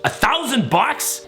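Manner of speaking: high, drawn-out disbelieving question; incredulous. Retold or imitated. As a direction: stretch it out in disbelief at the price. Outraged disbelief at the price.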